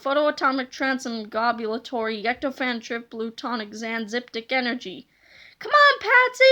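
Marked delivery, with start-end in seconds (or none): 5.6-6.4 higher urging call over noise; high call over the din; shouted. A narrator's reading. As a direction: cheer the machine on, high. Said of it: "George shouted over the horrible noise".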